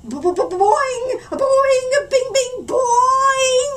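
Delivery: high, loud cartoon spring noises; springy boings. A narrator's reading. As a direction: bounce each boing like a spring. Hair springs up comically.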